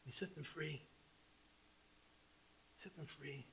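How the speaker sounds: very soft, low repeat; hushed reverence. Real, not acted. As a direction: let it drop almost to nothing. Hushed repeat after forceful preaching.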